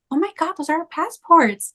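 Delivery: high, stunned disbelief; shocked recognition. Retold or imitated. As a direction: realise they're your own passports. Recognising stolen passports.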